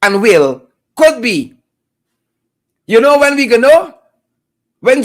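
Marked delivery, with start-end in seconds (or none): 1.0-1.5 Could punched, sceptical fall on be; Could be with heavy weight on Could; stress on could. Shown as a demonstration. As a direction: sneer on the word Could. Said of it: "Not shall be".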